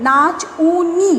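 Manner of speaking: নাচ উনি said slowly as two parts, নাচ then উনি; split into parts. Shown as a demonstration. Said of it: "আমি যখন নাচুনি শব্দটা উচ্চারণ করছি".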